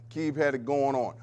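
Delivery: ordinary talk, nothing marked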